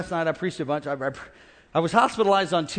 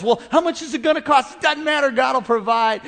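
mock exchange; 7 semitones higher, 7 dB louder than usual. Acted staff exchange.

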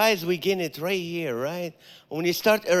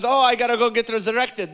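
nervous excuse; 6 semitones higher, 5 dB louder than usual. Retold or imitated. Nervous actor making an excuse.

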